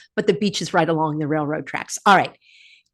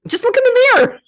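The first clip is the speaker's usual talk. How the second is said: very high, loud, cracking up; tickled by your own joke; laughing jibe; 5 dB louder, much faster than usual. Real, not acted. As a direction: squeal the joke through laughter. Joking, breaks into laughter.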